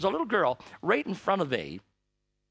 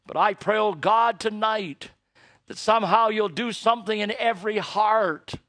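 fervent, raised pastoral prayer voice; public prayer; 5 semitones higher, 5 dB louder than usual. Acted. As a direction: lead the congregation in earnest prayer. Closing prayer.

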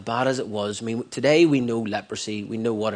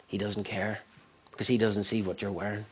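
ordinary talk, nothing marked

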